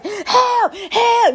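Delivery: high cry for help. Shown as a demonstration. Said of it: "be like".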